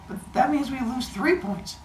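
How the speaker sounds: high-pitched mock kid objection; imitated protesting student. Teacher mimicking a student's protest, high imitated kid voice.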